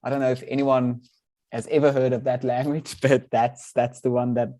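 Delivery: ordinary delivery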